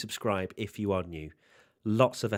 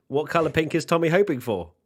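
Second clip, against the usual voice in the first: loud, higher-pitched reading of a question; raised read-out; 8 semitones higher, 8 dB louder than usual. Real, not acted. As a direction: put the question out loud and high. Chat question put to Tommy again.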